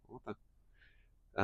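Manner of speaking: breathless whispered what the; whispered disbelief to himself; whispered aside. Retold or imitated. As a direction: hush it in disbelief. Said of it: "I was like".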